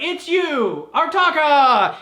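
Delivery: hammed-up realization; mock awed gasp. Heroes recognizing the masked figure, hammed-up and awed.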